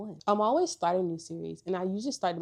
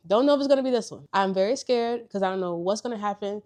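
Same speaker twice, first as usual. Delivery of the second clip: nervous excitement; 2 semitones higher, 8 dB louder than usual. Real, not acted. Nervous about a sequel.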